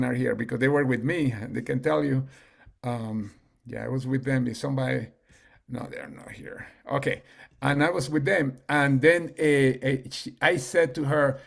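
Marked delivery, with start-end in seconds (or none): none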